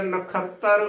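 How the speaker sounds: ਨਖੱਤਰ said na-khattar, no e glide; wrong pronunciation. Shown as a demonstration. Teacher shows the mistake, ਨਖੱਤਰ as na-khattar. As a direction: misread ਨਖੱਤਰ with a bare short a after ਖ. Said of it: "ਉਚਾਰਨ ਬਿਲਕੁਲ ਨਹੀਂ ਕਰਨਾ".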